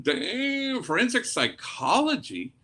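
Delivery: high surprised Damn, then a questioning echo; stunned priest repeats the daughter's answer; amazed echo. Retold or imitated. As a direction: act impressed and caught off guard.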